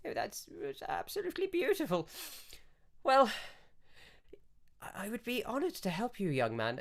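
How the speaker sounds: put-on high, choked-up elderly lady; a moved old priestess dabbing her eyes; tearful old priestess. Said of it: "she goes".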